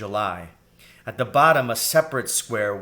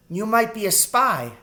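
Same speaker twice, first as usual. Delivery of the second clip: put-on high child's voice, suspicious; young and wary, pitched well up; accusing girl rat; 11 semitones higher, 5 dB louder than usual. A narrator's reading.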